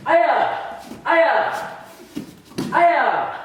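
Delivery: three high, sharp martial arts yells; kiai shouts. Shown as a demonstration. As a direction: snap each aya out with the strike.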